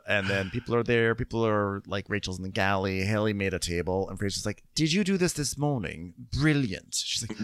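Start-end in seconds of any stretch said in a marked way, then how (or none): 4.7-7.0 higher, lilting mock compliment; imitating the captain's clipped praise; mock posh praise. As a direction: put on a prim captain's voice. Imitating Frasier's British praise.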